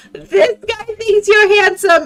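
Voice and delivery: high, slow, loud teasing yell; shouting back to a friend about the dingo, mischievous; yelled over shoulder. Acted. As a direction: call it back over your shoulder, laughing. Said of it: "I look over my shoulder and I yell".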